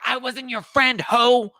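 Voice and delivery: high, loud mocking shriek of a reality star; imitating a reality star's screeching insult; mock Lala yell. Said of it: "And then Lala's like".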